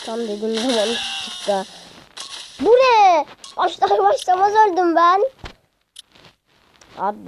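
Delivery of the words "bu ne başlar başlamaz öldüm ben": loud, high burst of dismay at dying; frustrated outcry. Real, not acted. Gamer yelling at the game after dying instantly, loud outcry. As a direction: yell it like you just lost instantly.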